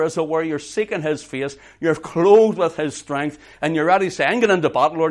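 loud, driving sermon voice; full-force preaching. Acted. Preacher urging the congregation to arm themselves, loud and driving. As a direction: push every phrase out to the back row.

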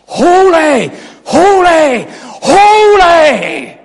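high, loud, drawn-out shout; crying out in worship. Acted. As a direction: cry it out like worshipping seraphim. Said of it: "they're crying before the Lord".

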